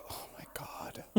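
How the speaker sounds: breathy whispered gasp; whispered oh my God. Retold or imitated. Friends mocking ScarJo's reaction, whispered. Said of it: "And ScarJo's like".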